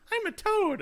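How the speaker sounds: very high, loud cartoon voice; mocked toad voice. Host mocking the game's toad casting, high loud voice. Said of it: "but they're like".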